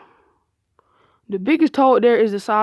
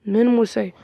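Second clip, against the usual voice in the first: ordinary delivery, nothing marked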